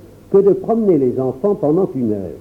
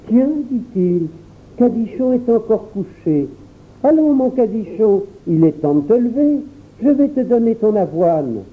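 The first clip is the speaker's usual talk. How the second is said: petit garçon; 6 semitones higher than usual. A narrator's reading. Child voice in story.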